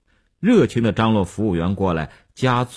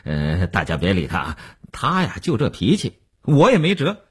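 self-mocking; 8 semitones higher, 3 dB louder than usual. A narrator's reading. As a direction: laugh off the embarrassment, then rally the table noisily. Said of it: "自我解嘲地对众人说". (先自我解嘲，再高声张罗敬酒)

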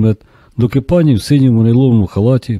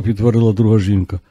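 usual speaking voice

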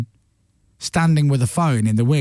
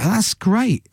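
higher-pitched delighted reaction; enthusiastic; 9 semitones higher, slower than usual. Retold or imitated. Host reacting to comedian's big gig, enthusiastic. Retelling his own enthusiastic message.

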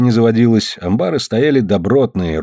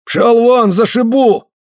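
high, raised angry threat; fierce rebuke; 11 semitones higher, 3 dB louder, slower than usual. A narrator's reading. Afanasy chases Smolkov out, fierce rebuke. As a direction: drive him off with a high, furious order. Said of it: "цыкнул яростно".

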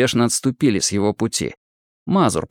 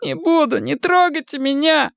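very high, pitiful protest; moaning plea. A narrator's reading. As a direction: plead in a high-pitched moan. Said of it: "простонал Женя". (жалобная мольба высоким голосом)

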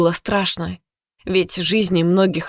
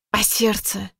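ordinary delivery, nothing marked

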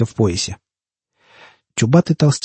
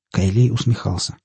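usual speaking voice

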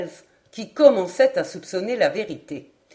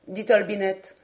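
ordinary delivery

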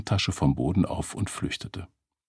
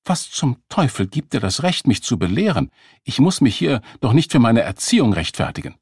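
indignant, sharp retort; woman snapping at her date over her parenting; snapping at him; 5 semitones higher, 5 dB louder than usual. Acted. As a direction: snap back at the lecturing date. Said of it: "Sie schnauzte ihn an".

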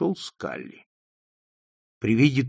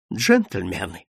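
lower, unsteady appeal; breaking voice; 5 semitones lower, slower than usual. A narrator's reading. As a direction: appeal to the room, voice failing. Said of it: "послышался его срывающийся голос". (срывающимся голосом)

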